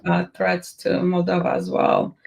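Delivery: usual speaking voice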